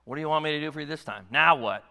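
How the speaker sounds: impatient, fast mock parent, ending in a loud snap; a fed-up parent rattling it off; annoyed parent. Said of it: "No, he's saying".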